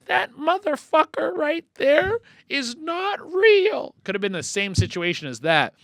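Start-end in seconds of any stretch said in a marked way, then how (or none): 0.1-3.9 high, slow, drawn-out mimicry of a woman; that lady's outburst. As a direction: imitate her stretched-out, high-pitched declaration. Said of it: "that lady that was like".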